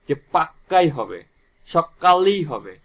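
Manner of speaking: পাক্কাই and সকালেই said slow and pressed hard; emphatic stress. Shown as a demonstration. Teacher showing students the emphasis of doubling, slow and forceful. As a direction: hammer পাক্কাই হবে, সকালেই হবে home slowly. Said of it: "জোর দিয়ে বোঝাচ্ছে".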